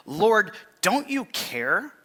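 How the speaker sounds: pleading complaint. Acted. Martha pleading with Jesus.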